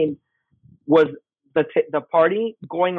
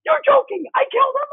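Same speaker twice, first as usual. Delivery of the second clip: excited disbelief. Retold or imitated. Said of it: "And my daughter's like".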